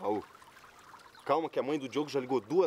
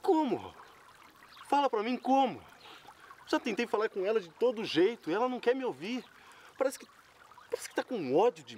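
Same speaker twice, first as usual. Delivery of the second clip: anguished; 9 semitones higher than usual. Acted. Desperate man pleads with friend.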